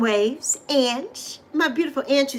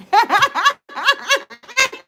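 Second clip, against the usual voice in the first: bright, higher laugh; 10 semitones higher, 3 dB louder than usual. Real, not acted. Host laughing at herself for crying on the mic.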